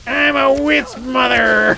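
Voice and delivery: high, loud, slow creature screech; hammed-up monster voice, words drawn out; Wisp mother voice. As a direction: wail it out like an angry spirit. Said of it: "I feel like she's like".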